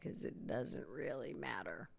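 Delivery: softly whispered throwaway; whispered aside. Real, not acted. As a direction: drop into a whisper to dismiss it. Dismissive aside.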